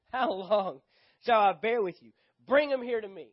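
loud, exasperated scripture reading; frustrated, rising into a forceful command; Jesus. A narrator's reading. Jesus orders the boy brought to him, loudly frustrated. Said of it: "you can just sense the frustration".

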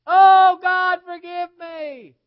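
huge high-pitched holler, words stretched out in anguish; hollered wailing. Retold or imitated. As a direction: imitate a woman hollering in torment at full volume. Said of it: "of hollering".

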